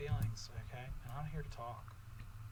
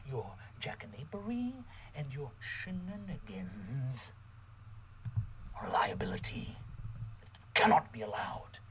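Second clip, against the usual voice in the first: slow, hushed, menacing villain voice; menacing whisper; 2 semitones lower than usual. Acted. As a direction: whisper the threat like a cold villain. Vice principal's whispered threat.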